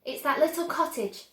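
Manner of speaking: eager, showing the way; Charlie voice. A narrator's reading. Charlie showing Wonka his house, eager.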